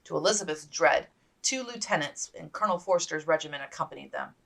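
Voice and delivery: ordinary delivery, nothing marked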